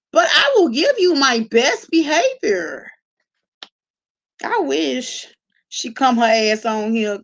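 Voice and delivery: ordinary talk, nothing marked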